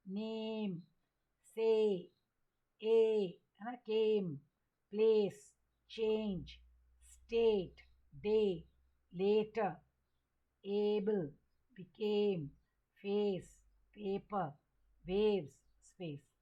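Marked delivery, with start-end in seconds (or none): 0.1-3.4 each word alone, its A held long; long A demo. Shown as a demonstration. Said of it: "ए को कैसे long किया". 3.7-16.3 teacher's word list with drawn-out A as in फेस; long A demo. Shown as a demonstration. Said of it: "ए को कैसे long किया".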